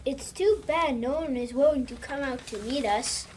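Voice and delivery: ordinary delivery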